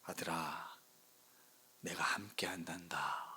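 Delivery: low, slow whisper of God to his son; God's voice. Retold or imitated. God speaking to the pastor on his walk, slow low whisper. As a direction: hush it slowly, a father's promise. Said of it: "강력한 음성을 주시는데".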